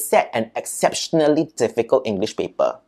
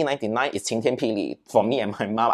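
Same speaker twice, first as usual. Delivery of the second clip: normal talking voice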